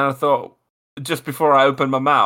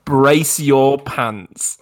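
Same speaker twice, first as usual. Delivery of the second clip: very slow, stretched warning; drawn-out warning; 2 semitones lower, much slower than usual. Acted. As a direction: draw each word out teasingly. Setting up the next game.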